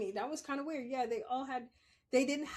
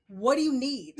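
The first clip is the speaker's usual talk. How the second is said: loud, higher, impatient mock demand; exaggerated brusque question, much louder; brusque old manner; 4 semitones higher, 11 dB louder than usual. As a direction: demand it like your old hard self. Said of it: "that was not cool".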